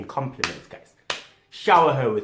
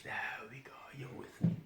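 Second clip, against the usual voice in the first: hushed, intimate whisper; 3 semitones higher, 15 dB quieter, faster than usual. Shown as a demonstration. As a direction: drop to a soft whisper. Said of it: "you take it down a notch".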